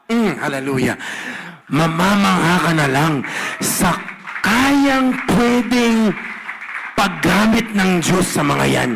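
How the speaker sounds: loud, slow preacher build; preaching at full force. Acted. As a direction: belt it out to the church. Preaching climax to congregation. (malakas na pangangaral)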